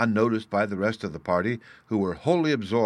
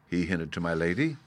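ordinary delivery

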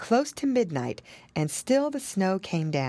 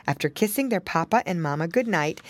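usual speaking voice

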